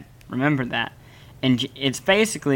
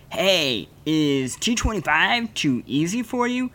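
higher-pitched hype ad voice, drawing out the last words; mock commercial pitchman; 4 semitones higher than usual. Imitating a workout ad pushing a harder program, mock pitchman. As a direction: imitate a pushy workout infomercial. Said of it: "And it's basically like".

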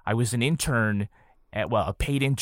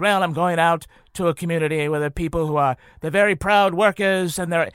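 put-on loud, raised Boston Kennedy accent; 6 semitones higher, 4 dB louder than usual. Imitating Ted Kennedy introducing himself downtown, Kennedy accent. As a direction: orate it in a broad Kennedy accent. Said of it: "get into the accent now".

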